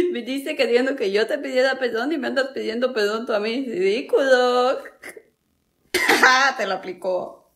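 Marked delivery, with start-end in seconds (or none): none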